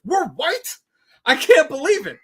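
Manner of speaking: high, loud fake astonishment; mock shock. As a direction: gasp it out in mock disbelief. Sarcastic reply to a commenter.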